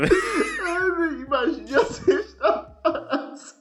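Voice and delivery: high, slow, sobbing laugh; laughing till tears come; crying with laughter. Real, not acted. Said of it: "Eu tô chorando de rir".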